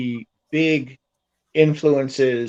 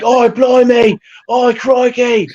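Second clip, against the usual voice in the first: exaggerated Australian exclamations, pitched high and loud; Aussie voice; 9 semitones higher, 5 dB louder, slower than usual. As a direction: ham up a colloquial Aussie accent. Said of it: "in your very colloquial Aussie voice".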